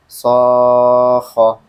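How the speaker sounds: SOKHOH held long and level, single KH as in kholak; senza rialzo. Shown as a demonstration. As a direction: chant SO on one note, drop lightly onto KHOH. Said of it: "Sama kan?".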